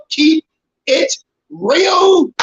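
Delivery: each word of Keep It Real set apart, rising; mocking slogan. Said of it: "under the heading of".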